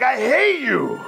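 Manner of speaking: high-pitched, drawn-out mock scream; retold screaming. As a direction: imitate an enraged friend screaming at you. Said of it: "He started screaming".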